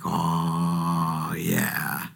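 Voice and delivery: low, soft, awed "oh, yeah"; swept up. Retold or imitated. Worshipper moved when the song hits, hushed awe. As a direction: let the song land on you. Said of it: "And I'm like".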